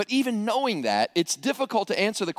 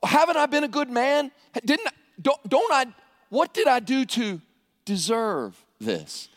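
crying out to God; 4 semitones higher than usual. Acted. Man with cancer news asking God why, anguished plea. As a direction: stammer the protest, then drop heavily at the end. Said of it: "he cries out to God".

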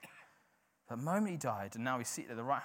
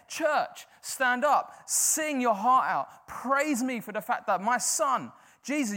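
high, loud, slow summons to stand; booming divine call to praise; rousing call of God; 11 semitones higher, 9 dB louder, slower than usual. Acted. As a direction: command the church to rise. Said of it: "He's saying".

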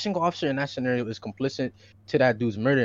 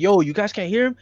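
quoting bystanders; 6 semitones higher, 3 dB louder than usual. Retold or imitated. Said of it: "they're saying".

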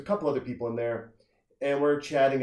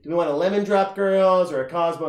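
imitating a party girl, higher and louder; 5 semitones higher, 7 dB louder than usual. Girl taking her friends' drink orders, mock party-girl voice. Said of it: "she's having a good time".

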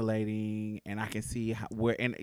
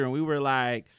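ordinary talk, nothing marked

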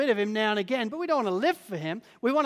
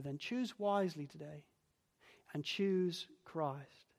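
soft earnest appeal; 4 semitones lower, 10 dB quieter, slower than usual. Real, not acted. Closing appeal to listeners.

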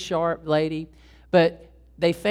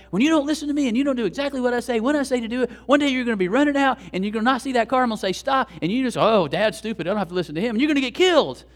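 red-faced dad lecture; 6 semitones higher, 4 dB louder, faster than usual. Retold or imitated. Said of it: "getting all red-faced with my kids".

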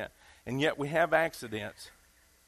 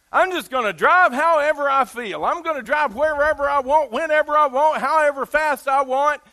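defiant reckless driver; 8 semitones higher, 7 dB louder than usual. Retold or imitated. Mocking a driver who follows feelings.

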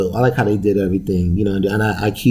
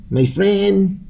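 high-pitched mock Spanish accent, drawn out; 10 semitones higher than usual. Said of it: "That's a stereotypical voice".

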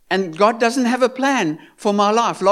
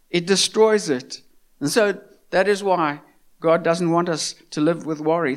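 ordinary talk

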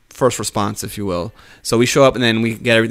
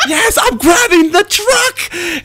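high, drawn-out excited squeal; giddy little kid; 4 dB louder, much slower than usual. Retold or imitated. Paramedic rushing to grab the truck, giddy as a kid. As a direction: cheer high like a kid who can't wait. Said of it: "I'm like a little kid".

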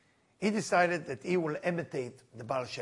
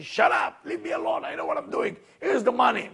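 angry retort; 5 semitones higher than usual. Retold or imitated. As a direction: tell her off, irritated drunk husband. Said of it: "getting very angry with her, saying".

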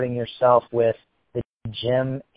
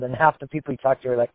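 ordinary talk, nothing marked

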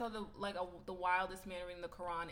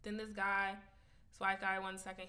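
ordinary talk

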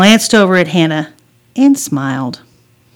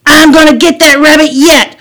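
put-on high, loud boyish threat; gleeful threat; 8 semitones higher, 9 dB louder than usual. Brother with slingshot after rabbit, loud threat. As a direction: shout it like a determined little hunter.

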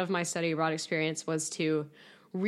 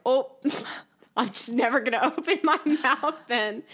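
laughing through a mock promise, pitched higher; laughing reply; 6 semitones higher, 3 dB louder than usual. Student answering her French professor, laughing. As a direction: giggle as you vow to stay silent. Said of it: "And I was like".

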